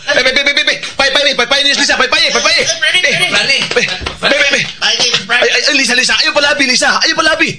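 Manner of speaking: high, rapid, insistent calling out; frantic calling. Acted. Woman frantically calling to Lisa at the wake, high and urgent. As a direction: hurry them along in a panic. Calling excitedly, hurrying someone.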